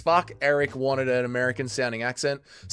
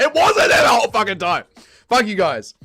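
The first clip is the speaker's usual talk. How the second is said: loud, high yell of protest; exasperated shout; 6 semitones higher, 6 dB louder than usual. Real, not acted. Streamer defending himself to teasing chat, exasperated shout. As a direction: blow up at the teasing.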